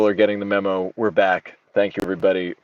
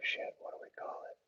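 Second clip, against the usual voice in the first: hushed muttered curse; whispered aside; 18 dB quieter, faster than usual. Real, not acted. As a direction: whisper it to yourself. Muttering to himself.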